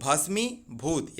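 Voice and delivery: भस्मीभूत drawn out very slowly; slow careful pronunciation. Shown as a demonstration. Teacher to students, slowly modelling भस्मीभूत. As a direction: stretch भस्मीभूत for students to copy. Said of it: "याद रखेंगे".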